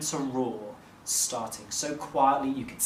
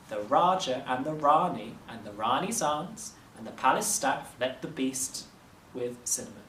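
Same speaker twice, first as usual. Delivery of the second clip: usual speaking voice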